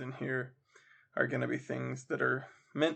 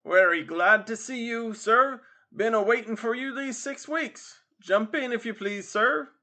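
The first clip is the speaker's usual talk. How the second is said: high, pushy cabman's welcome; mock-polite coachman; 8 semitones higher, 6 dB louder than usual. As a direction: hail the customer loudly with false deference.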